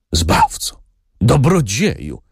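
high, moved exclamation; a touch of emotion, voice lifting. A narrator's reading. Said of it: "mówił wzruszonym głosem". (wzruszonym głosem, wysoko)

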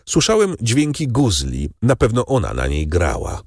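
lightly voiced Albert. A narrator's reading. Albert as storyteller.

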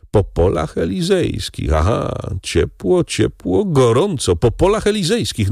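high-pitched, animated musing; pitched well up, lively and knowing; excited echoing. A narrator's reading. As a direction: seize on the clue with rising excitement. Andrea excitedly echoes Bertuccio's clue.

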